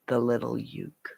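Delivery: soft reverent whisper; hushed whisper of the name. Real, not acted. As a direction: breathe the nickname with awe. Whispered nickname of father.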